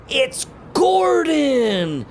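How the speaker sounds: astonished exclamation; big comic reveal; surprised reveal. Acted. Reveal of new bug king.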